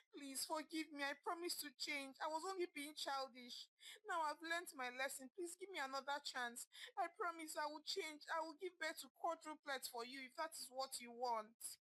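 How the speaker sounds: high, pleading voice, mildly tearful; wife on her knees begging her husband, tearfully; crying plea. A narrator's reading. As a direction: beg forgiveness through tears. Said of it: "Amara said, crying profusely".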